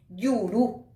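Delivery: Yuru with the last U stressed; stress on final U. Shown as a demonstration. Teacher shows an unwritten accent, final U of Yuru stressed. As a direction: put the weight on the final U of Yuru. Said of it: "sobre la U".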